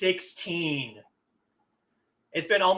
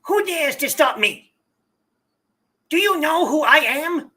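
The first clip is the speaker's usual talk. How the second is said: high, loud whiny weasel voice; 9 semitones higher, 6 dB louder than usual. Acted. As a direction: play a sniveling weasel of a rich brat. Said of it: "he's getting weasel voice".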